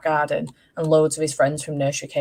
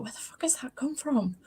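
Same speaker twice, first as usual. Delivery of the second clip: shocked whisper to herself; whispered inner thought; 9 semitones higher, 9 dB quieter than usual. Retold or imitated. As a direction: mutter it like a private shock.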